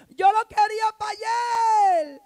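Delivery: high, loud, slow mock demand; hammed-up impatience at God; shouted mock impatience; impatient shout. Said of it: "¿Y sabe cómo hacemos?".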